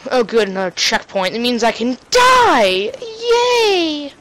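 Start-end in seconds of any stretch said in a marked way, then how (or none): none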